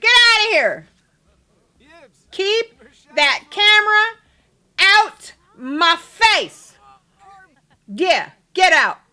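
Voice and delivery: loud, high bark with drawn-out menace; mean threat. Pretend. Scaring off paparazzi, loud mean threat. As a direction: order them off, loud and mean. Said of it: "we're gonna get mean with this one".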